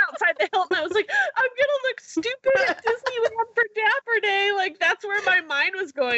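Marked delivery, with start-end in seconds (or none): 1.4-4.5 high, tearful, half-laughing lament; teary wail. Retold or imitated. Outside the hotel worrying about Disneyland, a high teary lament. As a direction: whine it through tears.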